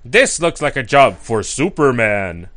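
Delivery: slow hero line, pitch dropping as it goes; Superman. Retold or imitated. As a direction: switch into a deep, declarative superhero voice mid-line. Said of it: "change voice as the line goes".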